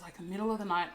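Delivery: normal talking voice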